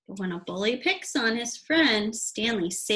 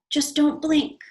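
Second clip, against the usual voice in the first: higher, louder teasing order; higher louder cheeky boy; playful warning; 6 semitones higher, 6 dB louder than usual. Acted. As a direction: warn with a grin. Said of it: "Arthur said".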